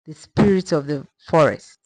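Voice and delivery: usual speaking voice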